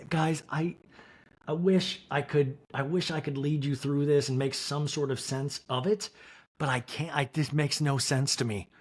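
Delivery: ordinary delivery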